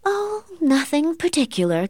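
high, airy show of not caring; feigned indifference. A narrator's reading. Catherine hiding her feelings from her cousin, high feigned indifference. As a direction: brush it off in a high, light voice. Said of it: "Catherine lied".

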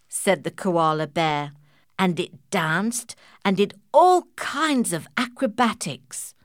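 ordinary delivery, nothing marked

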